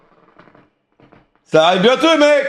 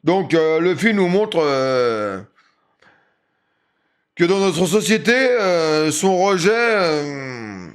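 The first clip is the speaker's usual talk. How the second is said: school presentation read; 4 semitones lower, 5 dB quieter than usual. Acted. Reads out a film essay.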